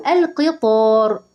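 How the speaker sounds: "القطار" slowly, with a heavy emphatic T. Shown as a demonstration. Teacher asking the class about the word, "القطار" slow with emphatic ط. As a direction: draw out "القطار" with a dark ط. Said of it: "يبقى القطار بالطاء".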